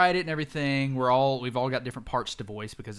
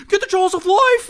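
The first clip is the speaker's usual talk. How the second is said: shouted rescuer cry, much higher and louder; hammed-up panicked shout; mock shout; 13 dB louder than usual. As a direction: yell it like a frantic first responder. Retelling chaotic sound clip.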